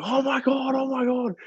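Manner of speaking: high squealing excitement; excited mate. Retold or imitated. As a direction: squeal it like your mate just saw your name. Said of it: "And he's going".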